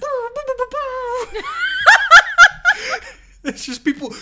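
high squealing laughter through words; laughing. Real, not acted. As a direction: break up laughing while talking. Laughing fit mid-rant.

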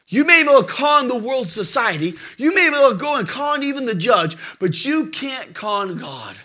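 usual speaking voice

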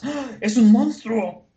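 high gasp, then loud drawn-out cry; hammed-up monster alarm; mock horror. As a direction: exclaim in exaggerated shock. Acts out shocked reaction to monster.

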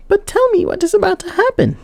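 put-on high girl's voice begging; entreating maiden. Maiden entreating the old woman, high pleading voice. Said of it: "the maiden continued to entreat".

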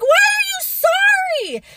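high, slow disbelieving question; high, drawn-out why are you sorry; incredulous. Retold or imitated. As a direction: squeak out the disbelief. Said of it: "And I'm like".

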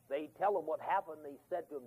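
ordinary talk

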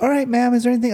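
a light mock of a chirpy caller; mock phone rep. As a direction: imitate the polite rep on the phone. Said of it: "They'll be like".

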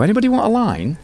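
high, wheedling offer; tempting offer. Retold or imitated. Hanger-on tempting the band with drugs, high wheedling voice. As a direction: dangle the temptation lightly. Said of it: "someone would go".

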